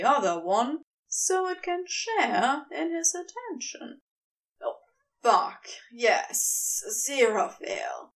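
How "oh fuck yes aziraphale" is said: understated wrecked cry; a touch of coming undone; mild cry of pleasure. Acted. Crowley crying out under Aziraphale's tongue, mildly wrecked. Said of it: "Crowley cries".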